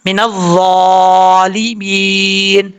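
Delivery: मिनज़्ज़ालिमीन slow, ज़ा full and heavy, no whistle; tajweed recitation. Shown as a demonstration. Teacher reciting the word the right way, slow and heavy. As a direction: draw out मिनज़्ज़ालिमीन slowly with a thick ज़ा. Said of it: "इस तरीके से".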